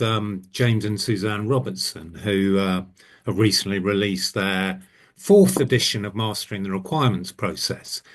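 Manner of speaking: usual speaking voice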